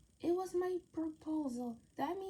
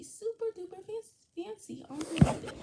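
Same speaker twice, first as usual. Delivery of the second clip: excited emphasis; 5 semitones higher, 8 dB louder than usual. Acted. Super duper fancy excitement.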